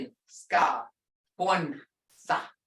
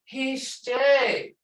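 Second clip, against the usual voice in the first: higher, heartfelt "Jay" greeting; with feeling; 5 semitones higher than usual. Shown as a demonstration. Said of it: "put some feeling into this".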